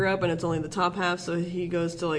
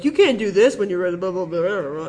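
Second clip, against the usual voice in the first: louder mock technobabble dissolving into nonsense; mock space jargon; 7 dB louder than usual. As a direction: imitate jargon, trailing into blah-blah. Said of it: "a bunch of made-up space language".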